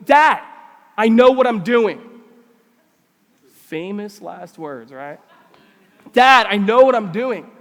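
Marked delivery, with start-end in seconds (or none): 0.1-2.0 loud acted teenage protest; exasperated teen. Teenage son rebuffing dad's warning, exasperated and loud. 6.1-7.5 loud acted teenage protest; exasperated teen. Teenage son rebuffing dad's warning, exasperated and loud.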